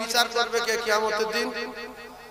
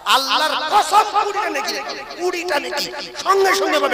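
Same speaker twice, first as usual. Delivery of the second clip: much higher, eager and laughing; excited promise; 6 semitones higher than usual. Acted. Preacher promising rewards to the crowd, excited and laughing. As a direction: promise it gleefully.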